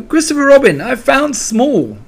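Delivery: very high, loud, thrilled call; excited cry. A narrator's reading. Piglet reports his find to Christopher Robin, thrilled cry. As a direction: shout it proudly, drawing out the end. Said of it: "cried Piglet".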